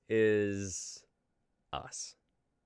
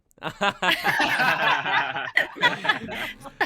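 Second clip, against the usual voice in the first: big hammed-up laugh, higher and louder; fake group laugh on cue; 12 dB louder than usual. Host cues the group to laugh at an imaginary clip, hammed-up laugh. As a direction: laugh on command like a sitcom track. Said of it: "we all laugh in three, two, one".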